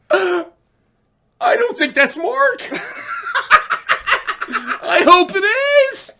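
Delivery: high-pitched, whimpering hope; hammed-up weepy fan; mock tearful voice. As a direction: squeak it out as if crying. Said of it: "I just cried and cried".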